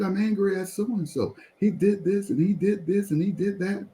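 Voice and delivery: high-pitched retold rant, listing grievances; going off. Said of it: "And I'm going off".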